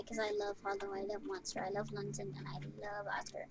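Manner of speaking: hushed affection for each pup; tender. Real, not acted. Tender list of the puppies.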